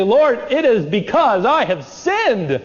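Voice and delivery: slow, earnest prayer; humbly owning up to God; slow heartfelt admission; sincere confession. Pretend. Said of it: "admit guilt and say".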